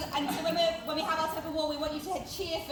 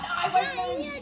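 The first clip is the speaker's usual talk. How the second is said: put-on stubborn kid; 9 semitones higher, 5 dB louder than usual. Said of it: "I love the kind of like".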